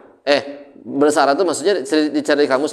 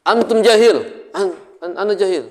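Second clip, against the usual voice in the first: high startled blurt, stammering; startled latah; 6 semitones higher than usual. Retold or imitated. Teacher acting a latah person startled by a bang, stammering blurt. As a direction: jump as if someone slammed the table.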